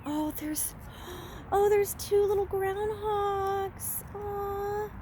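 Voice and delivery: high, slow cooing at little animals; delighted surprise. Real, not acted. Vlogger spots two groundhogs in the woods, delighted high coo.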